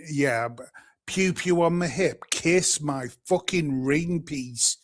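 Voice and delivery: usual speaking voice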